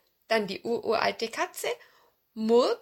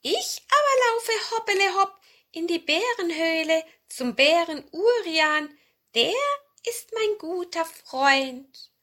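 high, loud cat voice; sing-song talking cat; meowing cat; 10 semitones higher, 6 dB louder than usual. Acted. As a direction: speak high like a cunning old cat. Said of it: "mauzte die ururalte Katze Murks".

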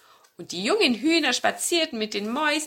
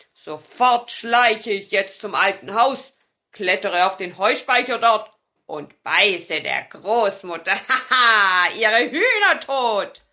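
a lightly voiced wicked marten, louder and pleased with himself; growling marten; 7 dB louder than usual. A narrator's reading. Wicked marten gloating over his plan, growling louder. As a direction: read the marten as a sly villain plotting, with a laugh in it. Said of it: "und knurrte".

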